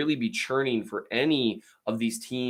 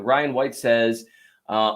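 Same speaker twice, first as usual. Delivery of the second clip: ordinary talk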